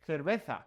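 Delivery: "cerveza" as "therbetha", Castilian style; Spain's TH sound. Shown as a demonstration. Teacher showing how Spain says beer, "cerveza" with TH. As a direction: say "cerveza" with C and Z as English TH. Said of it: "it's got that T H sound".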